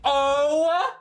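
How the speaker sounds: shrieking high in shock; shocked shout. Real, not acted. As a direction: scream in disbelief. Shocked reaction to plot twist.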